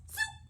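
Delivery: very high, quick zoop; sudden high whoosh word; zoop sound effect. A narrator's reading. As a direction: squeak out a quick dressing noise. Story sound effect of dressing.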